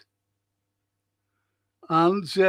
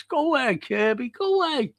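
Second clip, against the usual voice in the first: amazed exclamation; 7 semitones higher than usual. Real, not acted. Exclaims at a chat message about Kirby.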